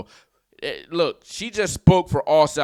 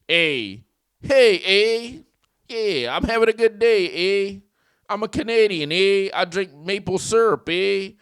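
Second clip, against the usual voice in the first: higher-pitched Canadian caricature; mock Canadian; 5 semitones higher, 3 dB louder than usual. American host making fun of Canucks, mock Canadian voice. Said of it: "That's all they say".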